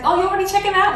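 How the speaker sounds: bright, high surprised clerk voice; a clerk chirping a question; chirpy clerk. Retold or imitated.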